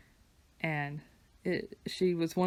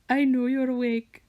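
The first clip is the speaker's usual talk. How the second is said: higher, louder sing-song calling to the cat; high-pitched pet voice; 7 semitones higher, 8 dB louder than usual. Retold or imitated. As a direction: coo at a sleepy cat in a squeaky voice. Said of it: "I had a high-pitched voice for her".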